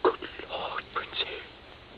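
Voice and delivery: hushed gasp of horror; horrified whisper. Acted. Captive whispers in horror on seeing the body. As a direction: breathe it out in shock.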